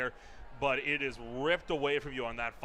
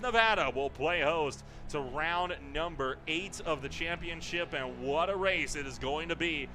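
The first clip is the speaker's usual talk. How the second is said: higher, louder broadcast sign-off; hype announcer; 3 semitones higher, 4 dB louder than usual. Acted. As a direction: sell the next race like a pro caster. Continues broadcast outro and ad read.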